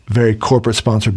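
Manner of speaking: ordinary delivery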